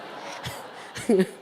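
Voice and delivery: voiceless little chuckle; short laugh. Real, not acted. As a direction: laugh lightly at running out of time. Laughs at running out of time. (소리 없이 짧게 웃음)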